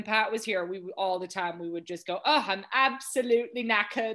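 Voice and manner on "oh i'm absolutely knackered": louder, higher mock British accent; hammed-up British exclamation; jokey British slang. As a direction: put on a playful British voice, loudly. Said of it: "as a joke".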